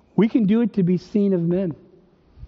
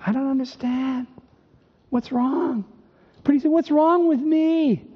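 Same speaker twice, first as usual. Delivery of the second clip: higher-pitched plaintive self-questioning; bewildered; 6 semitones higher than usual. Acted. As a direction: plead in baffled frustration with yourself. Voices a baffled, struggling believer.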